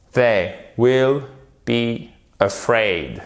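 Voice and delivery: "They will be afraid" slow, words set apart; detached words. Shown as a demonstration. Teacher leading everyone in repetition, slow detached words. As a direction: space out "They will", then draw out "be afraid". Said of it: "Vous détachez bien les mots".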